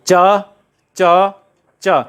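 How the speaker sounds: each च firm and held long; palatal cha drill. Shown as a demonstration. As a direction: repeat च separately, tongue on the palate. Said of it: "तालु से बोले जाने वाला प्रदेश". (च का तालु से स्पष्ट अभ्यास)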